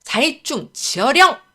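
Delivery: slow, loud spell-casting cry; shouted incantation. A narrator's reading. Scholar summoning a rat into the air, shouted incantation. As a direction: call out a spell, drawing it out. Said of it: "이때 윤선비가 다시 한번".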